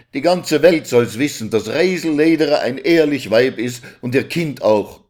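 a hint of a defiant mother; indignant wife. (leicht empörte, trotzige Ehefrau)

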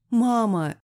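high, loud call for mother; desperate shout; crying out. A narrator's reading. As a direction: cry out after someone leaving. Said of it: "сама закричала".

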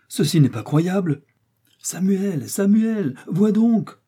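high, excited calling, building in volume; astonished outcry. A narrator's reading. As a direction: call a friend over in disbelief. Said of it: "s'écria Kennedy à son tour".

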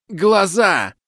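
high, loud warning shout; shout from behind the door. Acted. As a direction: yell the warning through the door. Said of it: "За дверью орут".

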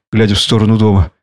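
usual speaking voice

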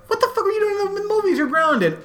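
very high, fast mock outrage; angry parent. Parents catching grounded Wesley at the movies, very high and fast. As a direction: squeal at him like a furious parent.

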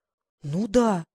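slow, drawn-out doubting "ну да"; disbelieving. A narrator's reading. Глаша doubts the trainer's speed, slow sceptical drawl. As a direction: drag it out in disbelief. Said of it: "не поверила Глаша".

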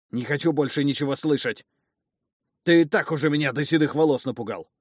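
loud, high frightened fury; angry shouting. Acted. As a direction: shout to drown out the words.

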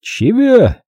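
high-pitched baffled question; недоумение. A narrator's reading. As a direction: ask it as if you didn't understand. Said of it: "не понял Аюб". (недоумённый вопрос высоким голосом)

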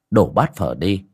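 ordinary delivery, nothing marked

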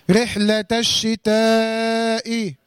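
الشتاء recited with its "aa" held very long before the hamza; sustained madd. Shown as a demonstration. Teacher showing the madd for students to name, drawn-out recitation. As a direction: stretch the ا of الشتاء on a level note. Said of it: "المد هنا نوع مد ايه؟".

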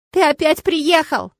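high, drawn-out exclamation; little boy delighted at a visitor; gleeful, stretched-out greeting; joyful child. A narrator's reading. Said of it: "радостно закричал он".

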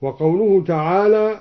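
usual speaking voice

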